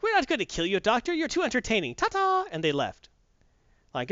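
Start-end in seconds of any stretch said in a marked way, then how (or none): 0.0-2.5 high, sing-song mocking droid voice. Droids tease the doctor on leaving, high and sing-song. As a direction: toss it off cheekily and trill the goodbye. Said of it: "It's like".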